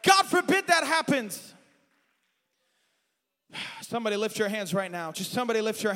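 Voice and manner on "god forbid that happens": higher, louder shouted preaching; full-force preaching. Acted. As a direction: cry it out over the music.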